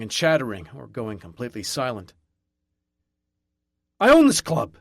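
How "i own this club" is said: much higher and much louder, roaring. A narrator's reading. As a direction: roar it at the whole bar. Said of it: "I roar".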